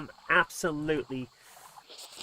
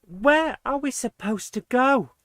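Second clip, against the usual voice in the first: higher, slow, fed-up question; exasperated; 8 semitones higher, 4 dB louder than usual. Real, not acted. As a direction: throw the question up in despair. Stuck, exasperated at game.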